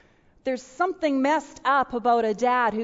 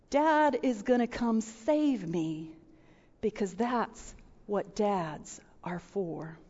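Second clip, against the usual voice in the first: soft, slow, tender; tender child's voice; 4 semitones lower, 6 dB quieter, slower than usual. Retold or imitated. Pastor quoting her five-year-old son, tender and soft. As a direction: say it softly like a trusting little boy. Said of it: "Little five-year-old".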